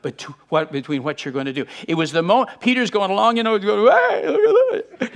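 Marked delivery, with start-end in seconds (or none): 3.9-5.1 very high, drawn-out squeal of wonder; mock giddy delight. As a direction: play Peter thrilled with himself, hammed-up. Acts Peter delighted on the waves.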